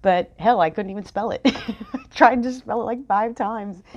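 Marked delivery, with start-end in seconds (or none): 1.5-2.1 breathy laugh. Real, not acted. Laughs at failing to spell it. 2.1-3.8 laughing. Real, not acted. Laughing about failed spelling.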